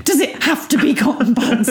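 high-pitched whiny imitation of a grumble; mock complaint. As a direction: mimic a put-upon complaint. Imitating his complaint about cotton buds.

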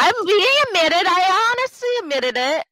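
high, loud squeal of protest; mock protest. Guest defends herself to co-host, high-pitched mock protest.

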